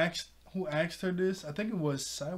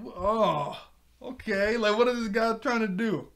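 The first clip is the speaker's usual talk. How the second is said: weirded-out; 7 semitones higher, 4 dB louder than usual. Retold or imitated. Weirded-out reaction to hugging.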